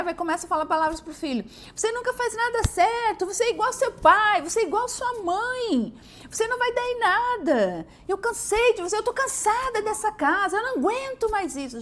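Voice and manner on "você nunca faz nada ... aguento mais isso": high, loud exasperated scolding; angry mother venting at her kid; berating mother. Retold or imitated. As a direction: tear into the child, fed up.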